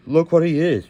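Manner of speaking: usual speaking voice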